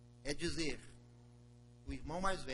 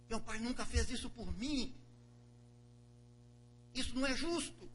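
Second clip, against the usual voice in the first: higher, bitter; sulking older brother; 8 semitones higher than usual. Acted. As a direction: complain it's unfair. Broer klaagt.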